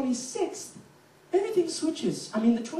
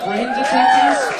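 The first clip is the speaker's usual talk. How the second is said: drawn-out high shout of the question; calling out to the crowd; 10 dB louder, slower than usual. Real, not acted. Comedian at a show hollering to the crowd, slow and loud. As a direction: belt it out to the room, slow.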